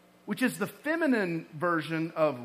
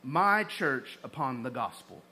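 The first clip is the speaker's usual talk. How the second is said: slow, weighty "I'm gonna build my church upon the gospel"; preacher driving home the point, slowed; slow emphasis; 3 dB louder than usual. Shown as a demonstration. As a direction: lay each word down deliberately. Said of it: "He said".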